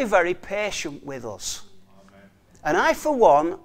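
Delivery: normal talking voice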